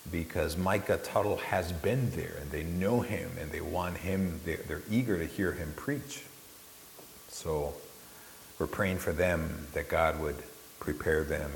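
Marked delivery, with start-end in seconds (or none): none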